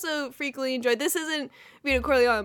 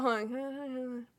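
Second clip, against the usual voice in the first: normal talking voice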